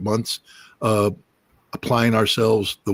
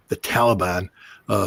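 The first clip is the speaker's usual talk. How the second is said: ordinary talk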